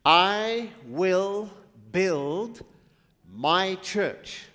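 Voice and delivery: loud preaching call; proclaimed loudly. Shown as a demonstration. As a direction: ring it out to the room. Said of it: "What did he say?".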